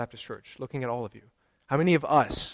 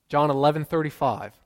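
normal talking voice

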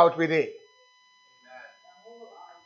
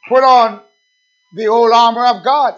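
preaching proclamation; 3 semitones higher, 6 dB louder than usual. Acted. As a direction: proclaim it to the whole church.

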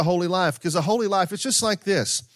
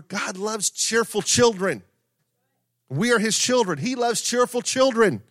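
rising preaching; 7 semitones higher than usual. Acted. Preaching rises in pitch.

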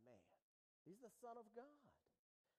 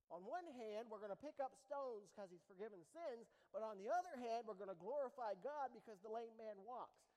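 louder, driving sermon delivery; full-force preaching; 2 semitones higher, 10 dB louder than usual. Acted. Pastor pressing the congregation on fickle reactions, loud preaching. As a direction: preach it to the back row.

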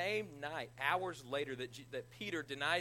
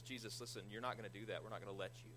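hushed aside; 5 semitones lower, 7 dB quieter, faster than usual. Retold or imitated. Peter pulls Jesus aside.

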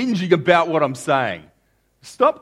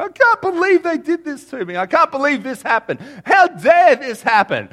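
mock outraged complainer; 12 semitones higher, 6 dB louder than usual. Pastor mimicking a congregant venting in his office, shrill mock outrage. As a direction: ham up a hurt church member ranting.

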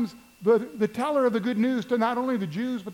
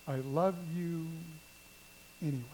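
tender; 3 semitones lower, 9 dB quieter than usual. Acted. God's grace spoken tenderly.

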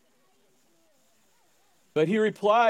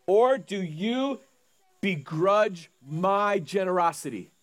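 slow pointed question; slower than usual. Acted.

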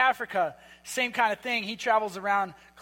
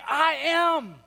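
"I am" pitched higher with the weight on "I"; stress on I; 6 semitones higher, 4 dB louder than usual. Shown as a demonstration. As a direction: hit the "I" in "I am" hard. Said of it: "emphasizing the I part".

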